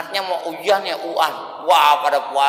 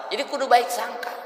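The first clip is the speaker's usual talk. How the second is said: usual speaking voice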